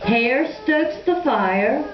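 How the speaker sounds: ordinary delivery, nothing marked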